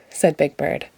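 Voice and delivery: plain reading voice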